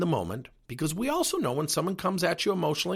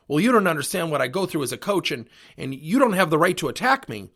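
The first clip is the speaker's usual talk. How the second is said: louder, defensive pushback; 3 semitones higher, 7 dB louder than usual. Retold or imitated. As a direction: snap back at the parent in self-defence.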